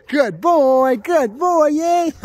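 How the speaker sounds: high excited dog praise. Real, not acted. Trainer praising the pup for the fetch, high and loud. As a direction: cheer the dog on, bright and loud.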